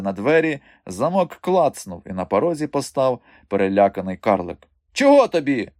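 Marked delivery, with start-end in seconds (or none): 4.9-5.7 high, loud peevish retort; grumpy retort. A narrator's reading. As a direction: snap back, annoyed at the visitor. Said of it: "буркнув він". (роздратоване буркотіння високим голосом)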